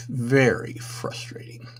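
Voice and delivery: slow whispered repeat of Very frustrating, no voice; exasperated whisper to himself. Real, not acted. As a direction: breathe Very frustrating out slowly as a weary aside. Repeats phrase, second time whispered.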